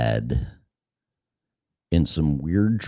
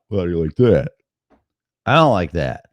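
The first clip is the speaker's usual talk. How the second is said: ordinary delivery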